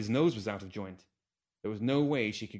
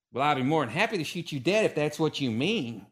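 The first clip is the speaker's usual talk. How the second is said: higher, louder growled threat; Calamity growls her acceptance of the duel; 5 semitones higher, 5 dB louder than usual. Acted. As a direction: growl it right back at him. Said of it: "she growled back at him".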